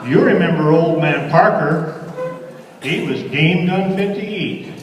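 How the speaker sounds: lively cowboy verse, lilting; cowboy poem recitation. Acted. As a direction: recite it like a campfire cowboy poet.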